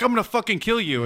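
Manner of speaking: high, strained empty threat; be a pinned kid bluffing; mock kid threat. Pinned schoolyard kid threatens the winner, high mock threat.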